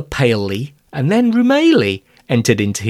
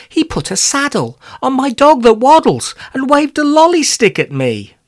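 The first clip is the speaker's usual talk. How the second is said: put-on high boy voice; 13 semitones higher, 4 dB louder than usual. Arthur complains to Romilly.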